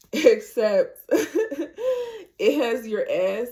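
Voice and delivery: slow, laughing through the words. Real, not acted. To the viewers, giggling about the faja's shape. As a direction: giggle as you explain.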